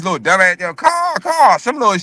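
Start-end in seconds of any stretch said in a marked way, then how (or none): 0.7-1.6 high, squawky bird call, mocking; kid's hawk call. Little cousin calling his hawk down, mock squawk. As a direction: mimic a child cawing for a hawk. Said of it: "call he doing this shit".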